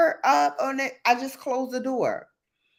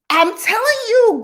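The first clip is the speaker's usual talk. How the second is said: loud, high insisting; I'm telling you, big and loud; emphatic; 12 semitones higher, 8 dB louder than usual. Real, not acted. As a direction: hammer it home to chat. Excited insistence to chat.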